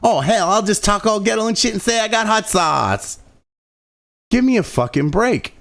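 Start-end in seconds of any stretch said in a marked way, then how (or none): 0.0-3.2 mock Hillary voice. Mocking Hillary Clinton pandering with a lightly put-on voice. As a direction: play a fake-folksy politician. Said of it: "is like".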